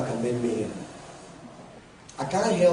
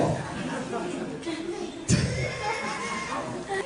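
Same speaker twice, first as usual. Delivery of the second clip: a burst of high laughter at his own joke; 8 dB quieter than usual. Real, not acted.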